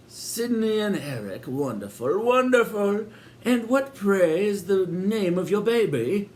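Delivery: high, sugary welcoming landlady voice; sinister hostess. A narrator's reading. Landlady welcoming Sid and Eric, high and sweet. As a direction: greet them grandly, a touch too sweet. Landlady asks about baby.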